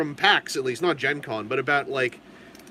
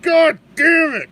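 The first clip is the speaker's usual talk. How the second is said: loud, high, slow curse; frustrated outburst; 10 semitones higher, 9 dB louder, slower than usual. Real, not acted. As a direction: yell it in exasperation. Frustrated outburst while painting.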